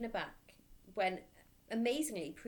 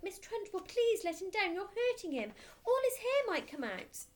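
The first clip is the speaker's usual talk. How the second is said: much higher, louder, anxious plea; pleading cry; 12 semitones higher, 5 dB louder than usual. Acted. Teacher begging the headmistress, high anxious plea. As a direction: beg her to stop. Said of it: "cried out".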